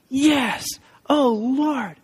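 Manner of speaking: high, heartfelt cry to God; grateful exclamation. Retold or imitated. Speaker thanking God for a hope-filled moment, exclaimed high. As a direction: lift the voice in relieved thanks. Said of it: "I just went".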